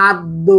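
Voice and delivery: আদ্য slowly as "ad-do"; final "o" shown. Shown as a demonstration. Teacher demonstrating the rule, আদ্য ending in round "o". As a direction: end আদ্য on a round, low "o". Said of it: "উচ্চারণ হয়ে যাচ্ছে ও".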